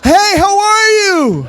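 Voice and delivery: high, drawn-out holler; imitated loud reply. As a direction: shout the greeting back at full volume. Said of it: "we just didn't speak loud enough".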